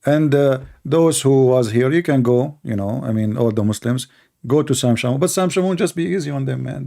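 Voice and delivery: ordinary talk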